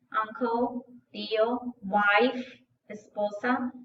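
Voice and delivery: ordinary talk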